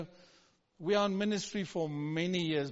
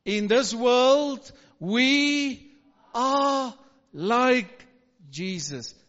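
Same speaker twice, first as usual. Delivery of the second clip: louder repeat; 4 semitones higher, 9 dB louder, much slower than usual. Shown as a demonstration. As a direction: say it again much louder. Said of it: "One more time".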